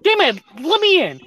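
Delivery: loud and high, pleading to be let in; frantic urging. Retold or imitated. Streamer reliving wanting into the debate, loud and frantic. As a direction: push hard to get into the fight. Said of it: "And I'm just like".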